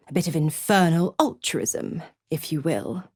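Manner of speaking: ordinary talk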